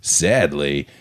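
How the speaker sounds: podcast segment read; story narration. Acted. Reads segment over music.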